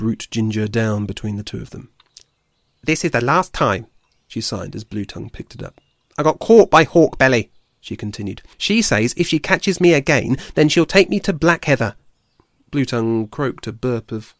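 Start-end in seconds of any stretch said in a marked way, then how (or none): 2.8-12.0 higher, louder complaining squirrel voice; a fed-up forager venting; irritable squirrel. Acted. Ashford complaining to Blue Tongue about the badger, fed-up voice.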